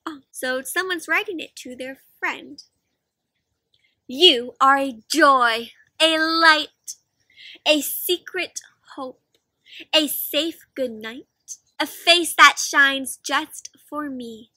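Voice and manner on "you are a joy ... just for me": slow, tender reading of a love letter. Shown as a demonstration. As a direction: read each line slowly like a poem. Reads the love letter aloud.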